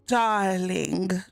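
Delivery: badly faked French accent. Reader imitating the lover's "darling", attempted French accent. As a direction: have a clumsy go at a French accent. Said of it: "with your lovely French accent".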